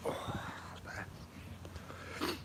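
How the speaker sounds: ordinary delivery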